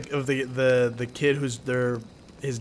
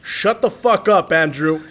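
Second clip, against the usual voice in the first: higher, louder and slow angry snap; a terrified dad barking at his son; scared dad snapping; 4 semitones higher, 6 dB louder, slower than usual. Retold or imitated. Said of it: "clenching the steering wheel and he goes".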